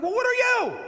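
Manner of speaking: loud, high-pitched impassioned preaching; fired-up pulpit cry, voice pushed way up; preaching at full force. Real, not acted. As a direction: challenge the congregation at a shout.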